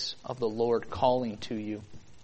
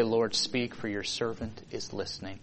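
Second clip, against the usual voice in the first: hushed prayer; 4 semitones lower, 8 dB quieter than usual. Retold or imitated. Quoting Samuel's humble prayer.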